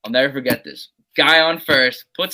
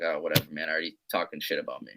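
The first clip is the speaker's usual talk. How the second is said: retold mutter; 8 semitones lower, 7 dB quieter, faster than usual. Retold muttering at teammates' gossip.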